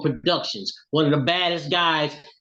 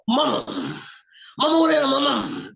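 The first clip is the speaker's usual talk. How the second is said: Ollie's voice; 12 semitones higher than usual. Retold or imitated. [name] on the phone asking his mother who is singing, high. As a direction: imitate him calling his mother through the phone, high. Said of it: "That's how he talked".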